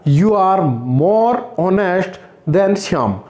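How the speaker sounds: very slow comparative; teacher dictating an example sentence slowly; slow dictation. Shown as a demonstration. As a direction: drag each word out for the class. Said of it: "मुझे कहना पड़ेगा".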